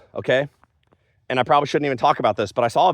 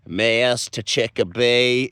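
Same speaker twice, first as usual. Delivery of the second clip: slow, drawn-out odd lady voice; lady talking funny; much slower than usual. Retold or imitated. Imitating a TV show lady from memory, slow funny talk. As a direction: say it slow and weird, each syllable stretched. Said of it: "She says something like this".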